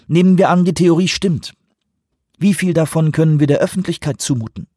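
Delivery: pointed high-pitched questioning; foreign minister, concerned. Acted. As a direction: put a pressing question to the room.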